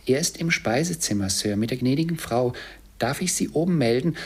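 high-pitched, polite maid; housemaid. A narrator's reading. Housemaid offers to announce Scrooge. (hohe, höfliche Dienstmädchenstimme)

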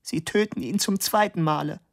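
a hint of a stricken mother; grieving accusation. Mother accuses the professor of killing her son, strained and high.

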